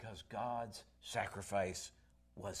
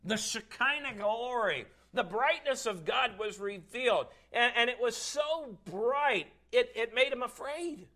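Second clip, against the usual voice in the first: high, loud, drawn-out proclamation; awestruck full-force preaching; 9 semitones higher, 7 dB louder than usual. Real, not acted. Preacher describing God's blinding glory to church, full force.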